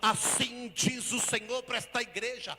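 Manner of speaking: loud, slow, sung-out preaching; preaching at full force. Real, not acted. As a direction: proclaim blessing over the church.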